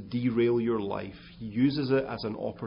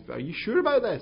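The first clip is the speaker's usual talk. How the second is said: high, worried are you sure; doubtful question to wife; 11 semitones higher, 5 dB louder than usual. Retold or imitated. Husband questioning his wife before pruning, high doubtful. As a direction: pitch up, asking his wife in disbelief. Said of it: "I'm saying to my wife".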